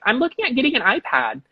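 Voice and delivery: high, put-on voice of an acquaintance; imitated old teacher. Middle school teacher messaging about an iPad, imitated. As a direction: mimic the teacher in a high lilt.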